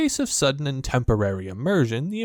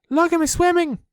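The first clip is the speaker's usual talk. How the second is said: very high, loud squeak; squeaky little Roo; 6 dB louder than usual. A narrator's reading. Roo showing off his swimming, high loud squeak. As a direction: squeak it like a proud tiny joey. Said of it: "squeaked Roo".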